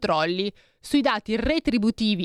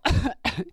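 tosse strozzata, più acuta; choking cough; 6 semitones higher than usual. Real, not acted. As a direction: tossire come se andasse di traverso. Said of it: "mi sto strozzando". (tosse soffocata)

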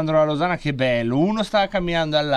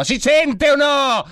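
loud, slow, high "si sente o no"; mock indignant shout; 10 semitones higher, 6 dB louder, slower than usual. Host mock-yelling at his listeners, loud and slow. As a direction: bellow it slowly at the audience.

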